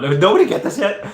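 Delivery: high, loud disbelief; incredulous. Real, not acted. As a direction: call it out in amazement. Surprised nobody guessed.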